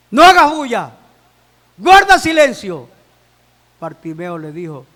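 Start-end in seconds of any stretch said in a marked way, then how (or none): 0.1-2.9 loud, forceful order to hush; loud scolding. Acted. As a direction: silence a noisy man with a shout. Disciples hush the shouting blind man.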